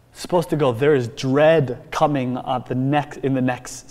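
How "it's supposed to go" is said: ordinary delivery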